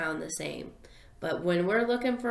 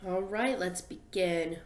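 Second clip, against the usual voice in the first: usual speaking voice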